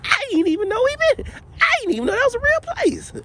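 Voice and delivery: mock excited squeal, very high; voice goes up. Host mimicking his own excited reaction, exaggerated high voice. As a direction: imitate your own excited voice shooting way up. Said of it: "my voice goes up".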